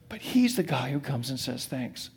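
quiet, sincere emphasis; soft restatement. Shown as a demonstration. Preacher to congregation, repeating the point softly. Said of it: "preacher restates softly".

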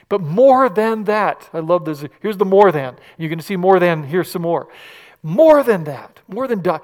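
loud, building preacher cry; preaching climax. Acted. Preacher rallying the congregation, loud.

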